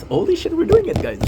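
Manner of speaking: high, loud thrilled outburst; excited. Real, not acted. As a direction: burst out in real disbelief. Celebrating marathon progress.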